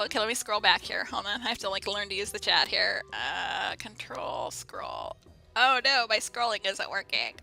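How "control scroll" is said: whispered. Real, not acted. Muttering to herself while scrolling.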